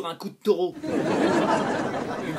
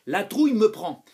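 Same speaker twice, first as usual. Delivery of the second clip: usual speaking voice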